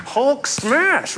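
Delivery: high-pitched mock battle cry; hammed-up angry outburst; mock Hulk rage. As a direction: playfully burst out in cartoon rage. Acting out the driver's rage.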